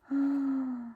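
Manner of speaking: gasp. Acted. Reader gasps at story twist.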